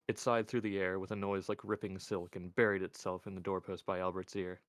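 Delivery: ordinary delivery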